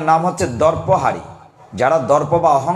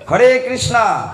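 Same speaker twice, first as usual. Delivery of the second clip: raised call of the chant; calling out loudly; 6 semitones higher, slower than usual. Shown as a demonstration. Preacher calling the crowd to chant loudly. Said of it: "জোরে বলেন".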